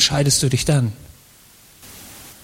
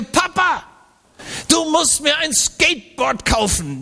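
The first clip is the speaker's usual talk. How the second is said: put-on much higher, a kid's whiny begging voice; pleading child voice; 9 semitones higher than usual. Son begging his father for a skateboard, child voice. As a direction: beg Papa like a small boy wanting a toy.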